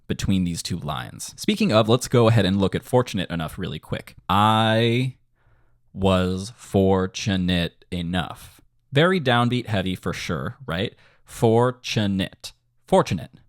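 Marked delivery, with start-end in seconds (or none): 4.3-8.6 slow, held syllables on the beat; measured lyric rhythm. Shown as a demonstration. Composer demonstrates a lyric line, slowly and in rhythm. 11.2-12.5 "Fortunate" slowly split, FOR-tu-nate; drawn-out syllables. Shown as a demonstration. Teacher checks the downbeat, "Fortunate" said slowly in syllables. Word drawn out versus natural saying.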